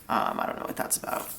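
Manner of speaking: usual speaking voice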